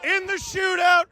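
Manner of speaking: big mock victory shout; hammed-up Sharks win call. Mock Sharks broadcast celebration.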